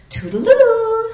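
high, loud sing-song goodbye; sing-song farewell. Acted. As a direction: sing it out as you flutter off. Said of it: "sang out Maisie".

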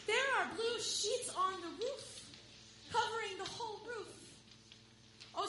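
put-on man telling the nervous girl the farm is covered in sheets; joyful. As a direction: announce the wonderful news, building delight.

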